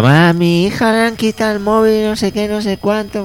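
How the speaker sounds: high, complaining mock voice; whiny neighbour. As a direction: whine like a worried mother. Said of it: "del rollo". (vecina quejica con voz aguda)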